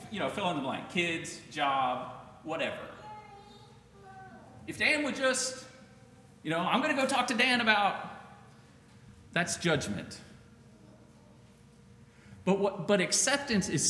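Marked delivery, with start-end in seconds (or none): 4.7-7.9 judgmental gossip. Retold or imitated. Voicing judgmental churchgoer.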